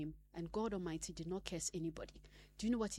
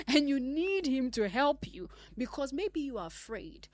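louder, higher, pleading preacher build; rising preaching; 4 semitones higher, 6 dB louder than usual. Acted. Preacher urging guilty believers to come to God. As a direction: preach it out, urging them forward.

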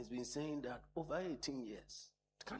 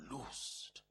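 ordinary talk

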